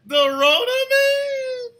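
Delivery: very high, loud, stretched disbelief; mock-offended high shriek; incredulous. Real, not acted. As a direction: squeal it in mock outrage. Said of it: "D T over in the TikTok chats".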